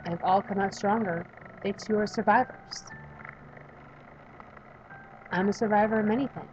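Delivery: ordinary delivery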